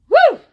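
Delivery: sharp high "woo"; overwhelmed little whoop. Real, not acted. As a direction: let out a loud high whoop. Exclaims at the memory.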